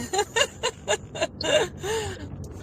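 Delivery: high-pitched delighted laugh. Real, not acted. Guest laughing at the host's praise, high-pitched. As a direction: let a high laugh burst out.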